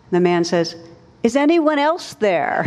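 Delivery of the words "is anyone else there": much higher, comic panicky man hanging from a vine. Retold or imitated. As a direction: squeak it out like a desperate man who wants other help. Punchline, man doubts God's answer.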